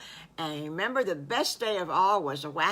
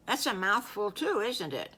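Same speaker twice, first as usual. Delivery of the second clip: ordinary delivery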